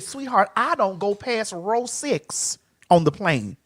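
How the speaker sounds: slow, soft, measured retold refusal; condescending refusal. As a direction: spell it out quietly, as if to a sweetheart.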